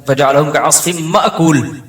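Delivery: मअकूल with a jolted bounce on the म, slow and deliberate; qalqala on meem. Retold or imitated. Imam demonstrating his old mistaken recitation, a jerk on म in मअकूल. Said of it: "म पे कलकला कर रहे हैं".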